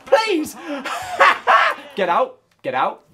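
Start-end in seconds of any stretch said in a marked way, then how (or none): 0.1-0.6 high, desperate please; squeaky high plea; pleading cry. Real, not acted. Begging the shot in. 0.6-1.7 high laughing shout; shrieking laugh. Real, not acted. Laughing after the miss.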